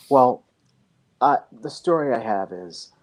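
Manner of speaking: ordinary talk, nothing marked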